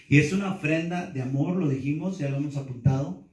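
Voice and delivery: usual speaking voice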